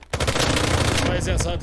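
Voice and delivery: loud, drawn-out team callout; shouted callout. Real, not acted. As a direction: yell it out slowly to the team. Urgent callout to teammates.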